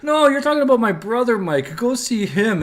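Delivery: a villager sending the player to his brother; another Mike. Retold or imitated. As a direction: brush the hero off to the next guy. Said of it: "And he's like".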